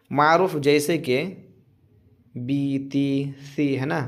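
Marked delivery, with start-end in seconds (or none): none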